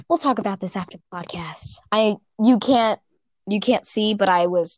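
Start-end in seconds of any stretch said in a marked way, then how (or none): 0.1-1.8 hushed whisper to a friend. Real, not acted. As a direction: whisper it so the mic misses it. Aside to co-host mid-recording.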